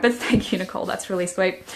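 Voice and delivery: normal talking voice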